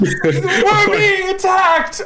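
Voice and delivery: high, loud, drawn-out alarm; alarmed shout. Acted. Wounded character warns the party, shouting in alarm. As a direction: yell a drawn-out warning to the party. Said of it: "You're like".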